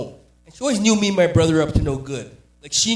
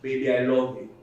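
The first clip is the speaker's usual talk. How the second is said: put-on Nigerian accent; 5 semitones lower, 4 dB louder than usual. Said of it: "Ask somebody from Africa".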